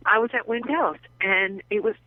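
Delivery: ordinary talk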